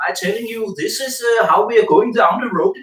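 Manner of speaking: exaggerated Copenhagen Danish twang; Copenhagen accent. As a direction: ham up a Copenhagen Danish English. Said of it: "They are talking like this".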